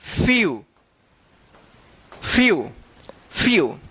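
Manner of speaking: फ्यू repeated alone, "fyoo", each falling; model reading of few. Shown as a demonstration. Teacher answers how to read it, फ्यू falling each time. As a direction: drill फ्यू three times with pauses. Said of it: "इसको क्या पढ़ेंगे?".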